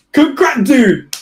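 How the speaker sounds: high, loud startled cry of dude; startled outburst. Real, not acted. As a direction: reader catches something in the screenshot, startled outburst. Spots the friend's exposed username.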